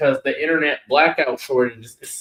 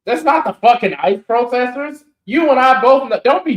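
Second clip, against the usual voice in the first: raised, higher protest; louder higher retort; heated insistence; 6 semitones higher, 4 dB louder than usual. Real, not acted. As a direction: push back hard at a friend. Heated argument with friend.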